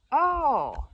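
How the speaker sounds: loud, high, long "oh"; big swooping penny-drop; exaggerated dawning understanding; sudden realization.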